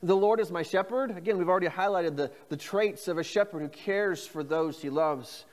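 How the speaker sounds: usual speaking voice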